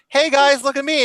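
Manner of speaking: a mock show-off shout from the tree; retold playful call. Tomboy teasing friends from the tree, mock call. Retelling movie scene, character voice.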